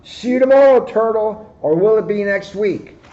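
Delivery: high, loud jeering call; rude shout. A narrator's reading. Hare zooms off shouting rudely at Muggs. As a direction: yell a rude goodbye over the shoulder. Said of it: "shouting rudely".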